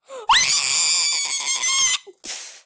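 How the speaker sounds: shrieking high laugh; wild laughing shriek; loud shriek of laughter. Real, not acted. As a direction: scream it out laughing. Screams into the mic.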